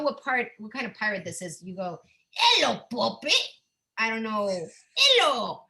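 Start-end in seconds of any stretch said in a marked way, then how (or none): 2.3-3.3 a hammed-up pirate greeting, higher and louder; lightly done pirate hailing someone. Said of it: "That's all I got for pirate talk". 5.0-5.6 a hammed-up pirate greeting, higher and louder; lightly done pirate hailing someone. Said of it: "That's all I got for pirate talk".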